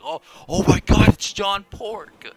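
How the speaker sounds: loud, high-pitched mock yell; retold yell. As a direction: yell it out like the startled guy, stretching the name. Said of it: "he freaking yells it out".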